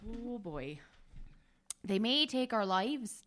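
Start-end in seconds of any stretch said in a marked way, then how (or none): 1.8-3.2 put-on accidental Scottish accent. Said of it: "Now you're going Scottish".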